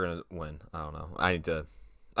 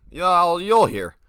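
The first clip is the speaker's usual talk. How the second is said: higher and louder teasing burst; loud tease; 9 semitones higher, 11 dB louder, slower than usual. Real, not acted. Vlogger teases his Miami video to viewers, loud and playful. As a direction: pop it out like a teaser.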